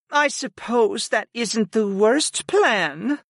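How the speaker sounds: slow, high, grudging agreement; reluctant. A narrator's reading. Servant weighing her master's backup plan, reluctant. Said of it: "Sarah said reluctantly".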